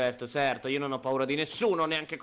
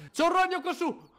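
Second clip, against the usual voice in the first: laughing alarm; 3 dB louder than usual. Real, not acted. Spots spider, laughing.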